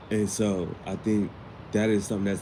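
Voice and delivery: ordinary talk, nothing marked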